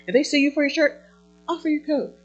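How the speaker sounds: ordinary talk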